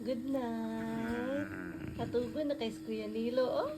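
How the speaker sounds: very soft bedside voice; hushed good night. Real, not acted. Caregiver saying good night to sleepy Kuya, hushed.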